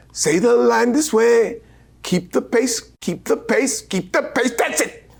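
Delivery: high, brisk English director; director giving notes. Retold or imitated. Director coaching actors to keep the pace, high voiced. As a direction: urge them on high. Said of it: "he directed like a conductor".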